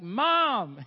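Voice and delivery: very loud, high, long shout; yelling. Retold or imitated. Son to mother who is not responding, insistent yelling. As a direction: shout it to make her hear. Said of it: "and he keeps yelling".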